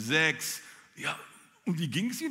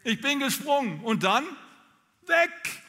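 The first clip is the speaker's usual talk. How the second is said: higher, louder, ending in a very high exclaim on weg; elated release; 10 semitones higher, 6 dB louder than usual. Retold or imitated. The jumper tells the congregation the moment she let go, higher and louder. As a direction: burst out free and high.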